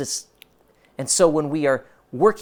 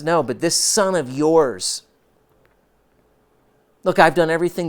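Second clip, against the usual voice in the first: resentful son; 3 semitones higher, 5 dB louder than usual. Acted. Older son protesting to father.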